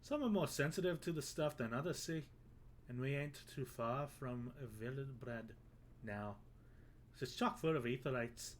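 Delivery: a hammed-up, horrible Irish brogue; bad Irish accent. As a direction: play the Irish sailor, badly on purpose. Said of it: "I've made him Irish".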